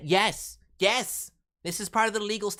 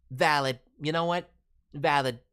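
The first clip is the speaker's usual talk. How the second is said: ordinary talk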